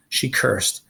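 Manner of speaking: usual speaking voice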